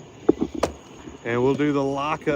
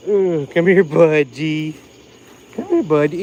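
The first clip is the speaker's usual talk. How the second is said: coaxing the dog; 9 dB louder than usual. Real, not acted. Sweet-talking the dog.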